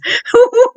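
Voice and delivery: high bright burst of holy laughter; joyful laugh. Real, not acted. Preacher laughing for joy in worship. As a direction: let a sudden high laugh break out.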